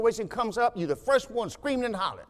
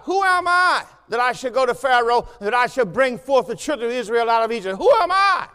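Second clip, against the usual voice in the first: Moses protesting; 4 dB louder than usual. Acted. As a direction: plead like Moses, louder with each line.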